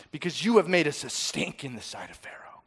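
hushed, bitter whisper of the complaint; whispered accusation. Acted. Whispering the Hebrews' complaint against Moses. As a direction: hiss it out unvoiced, like a grudge.

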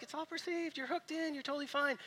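high, soft, soothing calm-down voice; reassuring instructor. Retold or imitated. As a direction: talk a scared climber down gently. Guide calming terrified climbers.

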